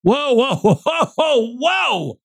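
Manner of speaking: high, laughing whoas as if spooked; mock startled whoa. Host hamming up his discomfort at the book's wording, startled. Mock recoil at mushy sentence.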